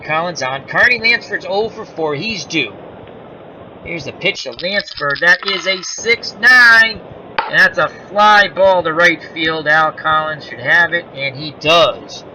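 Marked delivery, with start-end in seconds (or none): 5.2-12.2 play-by-play call. Acted. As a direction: call the fly ball live. Calls the fly ball.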